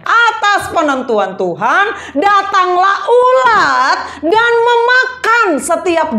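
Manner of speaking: high, slow, booming scripture declamation; dramatic sermon recitation; forceful preaching. Real, not acted. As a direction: proclaim the verse with drawn-out stressed words.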